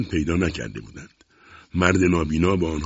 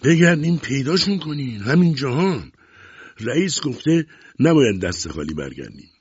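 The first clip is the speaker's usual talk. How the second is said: high, raised voice, easing toward the end; angry shout; 7 semitones higher, 3 dB louder than usual. A narrator's reading. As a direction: call out urgent orders to the gang. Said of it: "داد زد".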